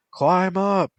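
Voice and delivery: high, loud call from above; shout. A narrator's reading. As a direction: shout the order to climb. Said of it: "a voice shouted".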